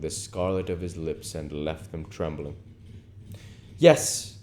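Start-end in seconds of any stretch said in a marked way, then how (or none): 3.8-4.4 lightly raised, persuasive aristocrat; a hint of the languid lord; Lord Henry voice. Lord Henry coaxing Dorian, lightly voiced aristocrat.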